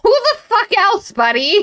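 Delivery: very high, loud, drawn-out comeback; mock incredulous retort. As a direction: throw it back slowly, as if it's obvious. Holmes answers astonished Watson.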